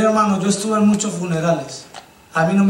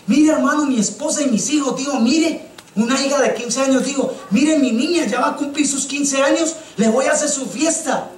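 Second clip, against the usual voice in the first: higher, eager and proud; proud happy father; 6 semitones higher than usual. Retold or imitated. A proud father introduces his wife and daughter, higher and eager. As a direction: boast about the daughter's coming party.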